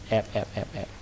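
real hush while hiding from the monster; urgent hush. Real, not acted. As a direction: keep it down so it won't hear.

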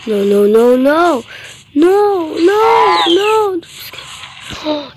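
rising, frantic string of no; panicked no's. Real, not acted. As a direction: panic climbing with each no. Panicking as dinosaur attacks.

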